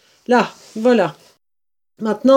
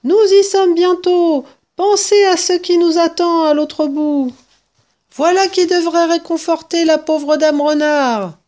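high, loud, eager call; breathless cheerful shouting; excited urging; 9 semitones higher, 5 dB louder than usual. Acted. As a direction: keep them running with excitement. Mr Fox urging on while running.

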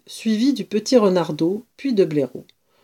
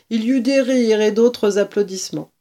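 ordinary delivery, nothing marked

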